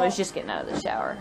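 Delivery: hushed whisper; quick hushed aside. Real, not acted. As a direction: whisper it so he won't hear. Telling kid quietly about dad.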